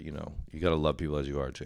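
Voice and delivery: ordinary talk, nothing marked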